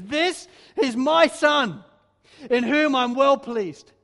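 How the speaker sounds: booming, slow proclamation from the heavens; loud and slow, a father's voice breaking in from heaven; voice of God the Father. Acted. The Father proclaiming over his baptized son, booming and slow. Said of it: "and says".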